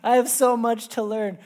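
slow, high and loud through tears and a laugh; choked up. Retold or imitated. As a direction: let the tears break into the words. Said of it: "just bawling".

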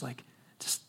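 awed whisper remembering trembling on the floor; hushed whisper. Retold or imitated. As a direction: breathe it out, hushed. Recalling body shaking on floor.

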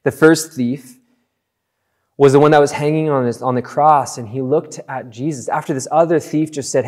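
ordinary talk

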